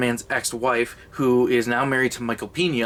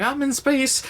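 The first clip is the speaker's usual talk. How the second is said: higher, thrilled selfie squeal; mock giddy excitement; 12 semitones higher than usual. Comedian voices KC taking space selfies, giddy and high. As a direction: gush like a tourist in space.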